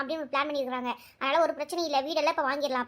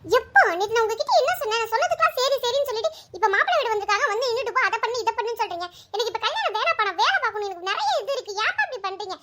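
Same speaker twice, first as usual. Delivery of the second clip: higher-voiced young daughter protesting to her father; daughter Anu; 7 semitones higher than usual. Daughter refusing to meet the groom, higher protesting voice. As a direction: plead with dad, pitched up and upset.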